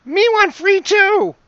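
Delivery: very high, loud creature screech; squealing kobold. Acted. Game master voices another struggling trapped kobold, high desperate yell. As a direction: squeal it like a struggling little monster.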